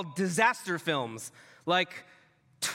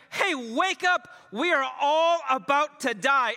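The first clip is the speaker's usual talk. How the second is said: louder, higher yell to wake him; panicked shout; 5 semitones higher, 5 dB louder than usual. Retold or imitated. Disciples shouting to wake Jesus.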